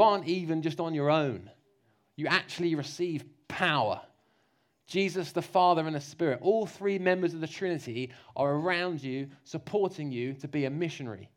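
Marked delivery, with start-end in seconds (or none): none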